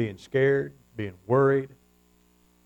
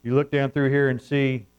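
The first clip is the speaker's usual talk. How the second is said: ordinary talk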